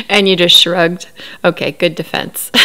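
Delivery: loud sarcastic jab, then dry. Real, not acted. Mocking Cody's defense to viewers, loud sarcasm. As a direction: needle him with mock praise.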